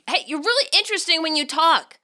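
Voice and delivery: teasing, playfully accusing; a light mock accusation. A narrator's reading. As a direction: pretend to scold a friend, grinning. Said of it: "with mock accusation".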